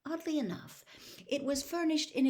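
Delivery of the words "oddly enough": plain reading voice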